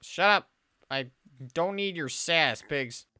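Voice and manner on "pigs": ordinary delivery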